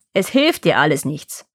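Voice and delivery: low, dismissive witch; scornful witch. A narrator's reading. Witch dismisses Gretel's tears, low scorn. Witch scolds the weeping girl.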